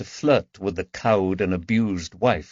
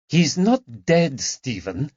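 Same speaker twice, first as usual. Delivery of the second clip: hissed reproach; 6 semitones higher, slower than usual. Acted. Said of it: "Magdalena hissed".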